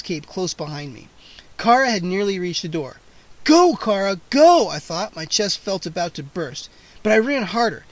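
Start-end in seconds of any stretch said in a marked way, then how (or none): 3.4-4.7 urging on. A narrator's reading. Urging friend on in chase.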